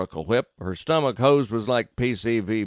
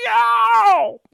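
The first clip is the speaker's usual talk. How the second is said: sudden high, loud yelp of pain; startled yelp; 8 dB louder than usual. Acted. Vet bitten mid-call by the dog, loud high yelp. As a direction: yelp as the jaws clamp on. Dog grabs the vet's leg.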